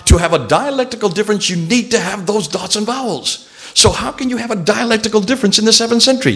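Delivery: rising indignant lecture voice; heated lecturing. Real, not acted. Lecturer builds to a heated point.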